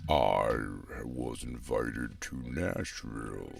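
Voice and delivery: very low, dragged-out slow voice; like a tape slowing to a screeching halt. Pretend. Host starting his story with rate slowed down, deep drawn-out voice. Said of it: "Can you slow my rate down".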